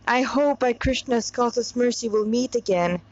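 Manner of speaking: ordinary delivery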